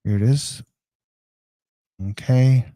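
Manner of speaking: normal talking voice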